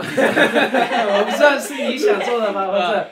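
laughing through the question; giggling while speaking. Real, not acted.

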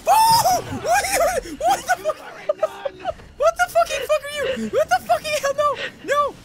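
high, laughing shout of shock; startled high yell at the jump scare. Real, not acted. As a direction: blurt it out half laughing, half terrified.

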